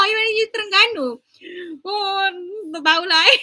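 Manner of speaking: laughing. Real, not acted. Laughing at her own attempt.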